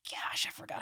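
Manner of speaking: hushed, awed whisper. Retold or imitated. Remembering the song on the drive, in an awed whisper. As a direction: breathe it out in quiet awe. Retelling his reaction to a song.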